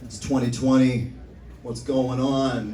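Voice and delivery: ordinary talk, nothing marked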